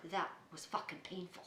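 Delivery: very soft and low, retold; subdued warning. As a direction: drop it to a grim murmur. Retold confession of pain after surgery.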